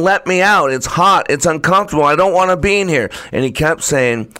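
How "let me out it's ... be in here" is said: put-on a wronged child begging to be let out; upset pleading. Teacup in the fire begging the potter, hurt and pleading.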